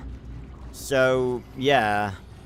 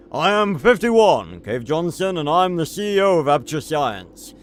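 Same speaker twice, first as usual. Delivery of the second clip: Cave Johnson voice; 4 semitones higher, 6 dB louder than usual. Retold or imitated. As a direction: announce it like a proud CEO. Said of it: "I gotta do it in the Cave Johnson voice".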